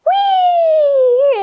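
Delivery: a tiny mouse squealing with glee. Pretend. Teacher voices the mouse squealing wee. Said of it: "The mouse said".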